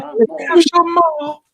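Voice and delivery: high, slow mock English voice; Oliver Twist line. Finishing the Oliver Twist joke in a mock English voice. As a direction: stretch it out like a Dickens character. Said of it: "Oliver Twist".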